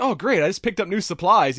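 sugary-sweet torturer delighted at his job; creepily nice Doctor Norm. Retold or imitated. Torture expert welcoming his job, creepily cheerful. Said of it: "so nice that it's fucking creepy".

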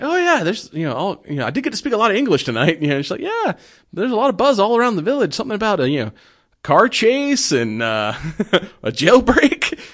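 mock Mrs Claus voice. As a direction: chirp it up high as a playful Mrs Claus.

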